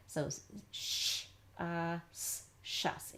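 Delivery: sounds "sh", "uh", "s" apart, then blends "shus"; sounded out. Shown as a demonstration. Teacher showing how shus is sounded out, said sound by sound. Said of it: "you could sound out shus".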